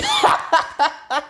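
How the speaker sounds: high mocking cackle; high teasing laugh; gloating laugh. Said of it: "Izuku just starts laughing".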